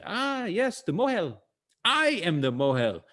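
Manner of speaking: lightly voiced old man, starting high and sliding down; a hint of an elderly shopkeeper answering the door. Old man greeting a new father at the door, lightly voiced.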